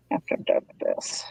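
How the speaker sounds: whispered aside. Real, not acted. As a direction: whisper it under your breath. Quiet aside while working.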